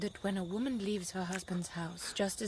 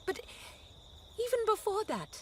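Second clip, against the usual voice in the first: agitated insistence; 7 semitones higher, faster than usual. Acted. Wife defying husband's duty talk.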